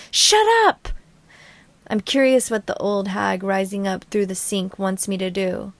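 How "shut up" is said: sudden high, loud scream; high-pitched yell to silence a voice. Acted. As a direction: scream it as if snapping awake. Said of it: "I snap awake and scream".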